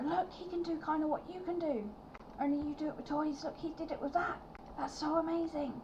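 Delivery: put-on children marvelling at the magic to the toymaker, high and thrilled; excited children. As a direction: gush like amazed little kids. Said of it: "look back to Doc and go".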